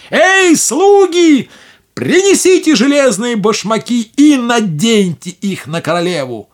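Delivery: high, loud witch barking orders; commanding shout. Acted.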